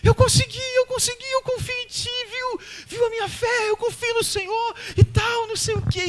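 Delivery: very high, squealing with joy; mock-thrilled high squeal of triumph; excited squealing. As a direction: shriek it out like a thrilled little girl. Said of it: "ela sai gritando".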